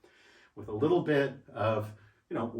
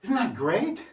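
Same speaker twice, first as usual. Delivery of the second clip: excited; 8 semitones higher, 4 dB louder than usual. Real, not acted. Enthusing about his exercise room.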